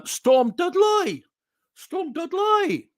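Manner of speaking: put-on high, drawn-out Brummie accent; exaggerated, slow West Midlands lilt. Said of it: "without doing a West Midlands accent".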